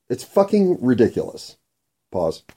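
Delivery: ordinary talk, nothing marked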